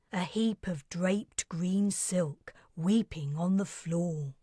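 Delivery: usual speaking voice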